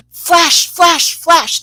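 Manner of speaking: high, loud mock command of 'flash'; half kidding. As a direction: call out each flash playfully, high and loud. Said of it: "half kidding, she goes".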